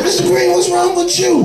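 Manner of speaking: high, pleading disbelief at a sudden attack; shocked cry. Retold or imitated. To Mister Green attacking him in prison, a high shocked plea. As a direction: cry it out as the blows land, stunned.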